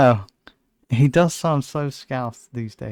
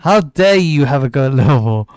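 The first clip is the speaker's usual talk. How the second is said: loud, hammed-up outrage; mock indignation; 6 dB louder than usual. Host imagining offended Liverpool listeners, mock indignant. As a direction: play an affronted local, louder.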